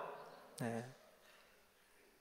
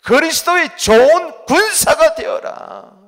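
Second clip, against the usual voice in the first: high, loud, slow preaching proclamation; solemn booming scripture quote; proclaimed scripture; 10 semitones higher, 12 dB louder, slower than usual. Acted. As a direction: thunder the verse out to the congregation.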